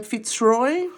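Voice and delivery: ordinary delivery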